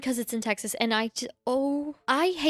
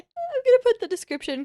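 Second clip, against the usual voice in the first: laughing; 13 semitones higher, 4 dB louder than usual. Real, not acted. Still laughing after blooper.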